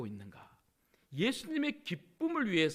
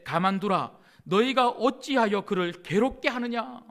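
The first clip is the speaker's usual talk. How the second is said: louder, commanding rebuke from Jesus; firm, raised voice of command; stern rebuke; 3 semitones higher, 6 dB louder than usual. Acted. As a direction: order them to leave her alone.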